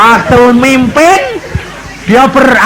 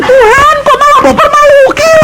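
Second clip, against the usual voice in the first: much higher, strained complaining woman's voice; embarrassed mother. Retold or imitated. Mother complaining to God after tongues came, high and whiny. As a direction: whine to God in a high put-on voice. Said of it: "Dia bilang".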